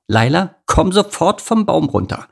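a touch of a scolding mum calling out; lightly voiced bossy mother; stern mother. Mother orders cub down.

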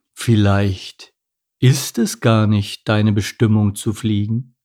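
slow, slightly altered character voice; a hint of a ponderous albatross; lightly voiced albatross. Albatross gently doubts penguin can fly.

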